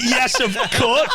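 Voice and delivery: obliging yes through laughter; humoured servant laughing along; laughing reply. Retold or imitated. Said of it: "I was like".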